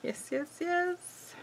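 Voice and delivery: soft high thrilled yes; giddy squeak. Real, not acted. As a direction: squeak with delight. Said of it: "I'm getting a little too excited".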